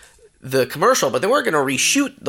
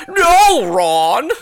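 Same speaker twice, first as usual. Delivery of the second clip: loud, very high cartoon cry; hammed-up, high-pitched cartoon protest; weird cartoon voice; 12 semitones higher, 6 dB louder, much slower than usual. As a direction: butt in as a frantic cartoon. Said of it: "cartoon voice would be like".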